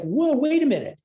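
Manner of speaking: high, alarmed mock protest; a newborn balking at the world; exaggerated high-pitched whoa; startled baby. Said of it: "but you're like".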